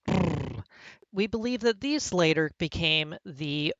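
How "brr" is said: brr as a rolled lip buzz; trilled labial consonant. Shown as a demonstration. Professor demonstrating the second odd consonant, lips trilled. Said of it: "trilled labial consonants".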